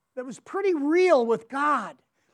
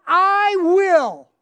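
declared resolve; 4 semitones higher, 7 dB louder than usual. Acted. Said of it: "Say, "I will".

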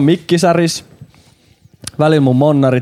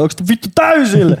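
higher-pitched mock holler; retold yell; 8 semitones higher than usual. Bandmate calling out about the volume, retold yell. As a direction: imitate a bandmate yelling on stage. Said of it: "Sit Jambo huutaa".

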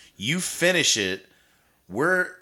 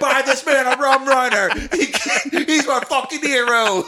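much higher, louder, laughing shout; laughing mock cheer; 8 dB louder than usual. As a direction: bellow it like a drunk fan. Bar celebrating, laughing.

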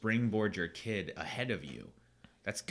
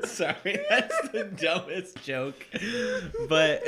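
high, loud, helpless laughter through the words; can barely get it out for giggling; laughing; 12 semitones higher, 9 dB louder, slower than usual. Real, not acted. Apologizing to a friend for the joke, laughing hard.

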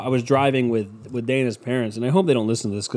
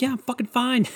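high, strained-sounding put-on reply; comic over-insistence, pitched way up; mock reassurance; 12 semitones higher than usual. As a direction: insist you're fine in a squeaky pitch. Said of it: "And I'm like".

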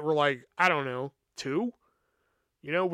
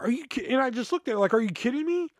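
disbelief; 7 semitones higher, faster than usual. Retold or imitated. Product knocked over, disbelief.

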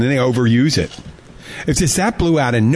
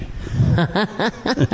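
higher-pitched chuckle after a punchline; laugh; 8 semitones higher, 4 dB quieter than usual. Real, not acted. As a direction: laugh at your own joke. Laughs at his own punchline.

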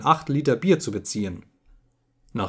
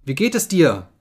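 worried; 4 semitones higher, 4 dB louder than usual. A narrator's reading. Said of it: "rief sie".

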